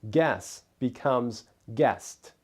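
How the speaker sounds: usual speaking voice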